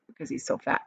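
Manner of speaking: ordinary delivery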